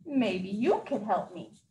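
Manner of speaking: low, slow, twinkly Santa voice; a sly kind idea, said slowly. Acted. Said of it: "with a twinkle in his eye".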